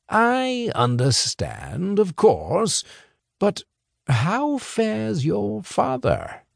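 put-on a smooth boss leaning on the boy's family; sly, slow persuasion. Boss slyly pressing the boy about his family, slow. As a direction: press gently and slowly, veiled threat.

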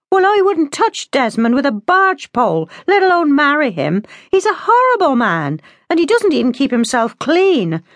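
put-on high-pitched indignant young woman; heated, high-voiced protest; indignant daughter. A narrator's reading. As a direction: refuse with open disgust. Daughter argues with father.

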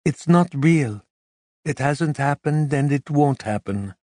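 calm her partner down; reassuring woman. A narrator's reading. Carmen reassures.

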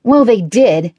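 indignant and defensive; haughty. A narrator's reading. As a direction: insist with wounded dignity. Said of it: "he replied haughtily".

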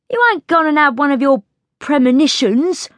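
high-pitched cockney-style maid; suspicious servant girl, pitched high; cross chambermaid. A narrator's reading. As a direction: challenge a friend, exasperated. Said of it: "she demanded crossly".

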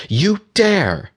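very high-pitched, indignant exclamation; breathed outrage. A narrator's reading. Aurora after her magic is snuffed out, outraged. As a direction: gasp it out, affronted and high. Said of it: "Aurora breathed".

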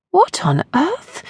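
ordinary delivery

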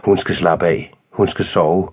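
usual speaking voice

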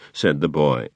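plain reading voice

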